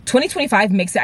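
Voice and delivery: ordinary delivery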